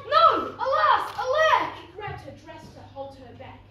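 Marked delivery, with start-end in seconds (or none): 0.1-1.7 loud, high cries of dismay; crying out. A narrator's reading. Prince as Cinderella runs away, crying out in dismay. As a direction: wail each word out loudly. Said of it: "The prince cried".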